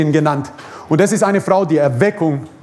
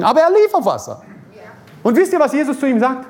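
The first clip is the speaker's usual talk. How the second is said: excited; 7 semitones higher, faster than usual. Real, not acted. Excited storytelling climax.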